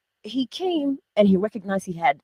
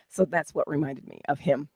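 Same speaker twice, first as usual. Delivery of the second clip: ordinary talk, nothing marked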